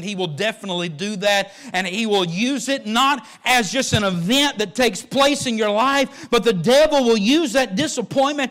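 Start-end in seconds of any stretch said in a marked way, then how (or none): 1.7-8.5 loud, high preaching build that slows at the end; full-force preaching. Acted. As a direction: preach it out to the whole church.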